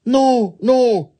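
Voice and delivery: high, loud protest; insistent wicked Oni. Acted. Wicked Oni contradicting Jizo, high insistence. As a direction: object in a loud, high demon voice.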